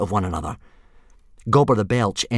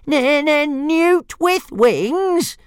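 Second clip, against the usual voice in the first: much higher and very slow, louder sing-song; squeaky dragon taunt; 4 dB louder, much slower than usual. Acted. Toothless parroting the insult back, very high and slow. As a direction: stretch every word in a shrill little-dragon mock. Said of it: "repeated Toothless".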